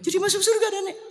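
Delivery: very high, quick squeal of disbelief; granny can't believe her luck; delighted old woman squeaking; surprised grandmother. Retold or imitated.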